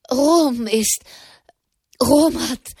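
high, broken gasping words; panting, halting cry; breathless stammer. Acted. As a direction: stumble out of breath. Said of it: "keuchte Malherb".

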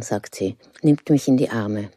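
plain reading voice

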